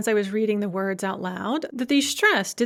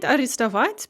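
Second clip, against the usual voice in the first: арестовать with the stress on its last syllable; stress on final syllable. Shown as a demonstration. Teacher reviewing infinitives, final syllable stressed in арестовать. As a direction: land the stress of арестовать on -вать. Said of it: "if the stress is on the at".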